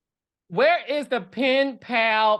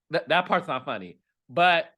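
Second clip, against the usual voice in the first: ordinary delivery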